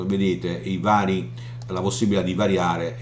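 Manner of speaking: ordinary delivery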